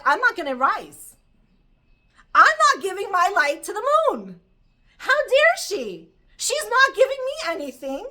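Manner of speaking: loud, high, outraged sun on strike; acted indignant refusal. The insulted sun refuses to shine for the moon, outraged. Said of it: "and the sun's like".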